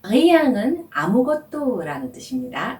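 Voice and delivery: ordinary talk, nothing marked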